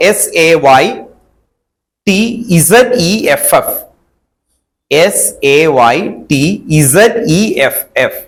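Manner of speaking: slow, letter by letter; spelled out. Shown as a demonstration. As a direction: spell each letter out slowly for dictation. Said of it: "spelling ഇങ്ങനെ എഴുതണം". (പതുക്കെ അക്ഷരം അക്ഷരമായി)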